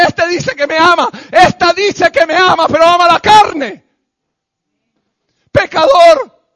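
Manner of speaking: loud anguished cry of the grieved Christ; Christ in shame. Acted. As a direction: cry out the betrayal as the wounded Lord. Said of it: "Cristo llora avergonzado y dice".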